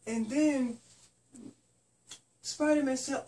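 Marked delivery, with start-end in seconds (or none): none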